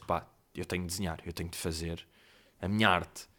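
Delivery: low, quiet thinking aloud; hushed inner urge. Acted. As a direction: murmur it low, like a private artistic calling. Voicing his urge to draw.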